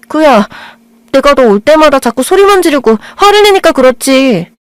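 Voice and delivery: indignant outburst. Acted. Blind girl snapping at the boy about his mother. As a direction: flare up, feeling wronged.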